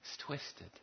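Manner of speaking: unvoiced whisper; whispered aside. Real, not acted. Preacher judges the cruel mother, whispering. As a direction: hush it out, appalled. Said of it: "aside whisper".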